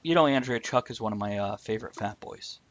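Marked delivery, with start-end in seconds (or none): none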